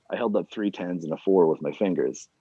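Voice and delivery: plain reading voice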